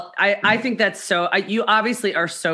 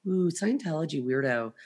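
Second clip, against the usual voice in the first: inner-thought aside; 5 semitones lower, 8 dB quieter than usual. Retold or imitated. Acting out soured inner opinion.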